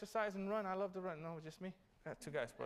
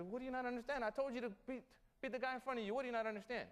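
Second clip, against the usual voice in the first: higher, impatient coach repeating himself; exasperated coach; 7 semitones higher, faster than usual. Retold or imitated. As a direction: coach scolding the confused runner.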